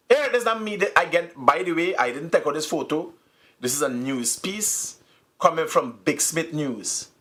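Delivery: ordinary delivery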